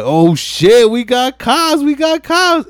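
panicked alarm. Retold or imitated. Leon spotting police.

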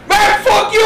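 high, loud retort; shouted comeback. Retold or imitated. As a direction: yell it back at him. Friend's retold comeback.

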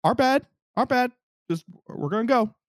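high-pitched placating retreat; retold backing off. Retold backing off from angry vendor.

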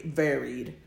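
ordinary talk, nothing marked

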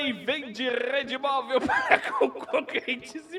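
high, laughing through the headline; can't stop laughing while reading. Real, not acted.

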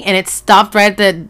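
normal talking voice

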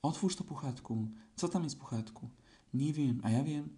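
ordinary delivery, nothing marked

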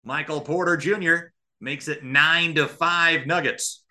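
usual speaking voice

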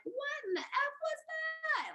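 very high squealed mock scream, held back in volume; retold scream. Snowboarder at the bottom after an hour's descent, retold scream. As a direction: imitate your own outraged shriek without full power. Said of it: "Like, I just screamed it".

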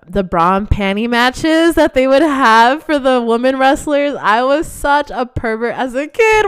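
loud, high, building toward hysterics; exclaiming in self-mockery. Real, not acted. Mock outrage at own childhood, building.

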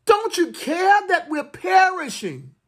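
anguished cry to the Lord. Acted. Disciples crying to Jesus in the storm, anguished. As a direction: call it out as a desperate prayer. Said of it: "This is the cry".

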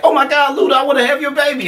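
high squealing fangirl. Retold or imitated. Friend mocking [name] crying over [name], high squeal. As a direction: gush like a starstruck fan. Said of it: "and [name] like".

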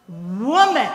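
Jesus answering his mother sassily; sassy. Retold or imitated. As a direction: toss the word back with attitude. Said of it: "kind of sassy almost".

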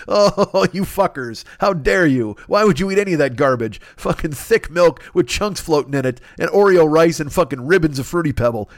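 ordinary delivery

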